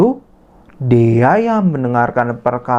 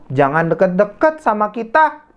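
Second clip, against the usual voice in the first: snobbish shooing; 8 semitones higher than usual. Acted. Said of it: "Lalu Rini bilang".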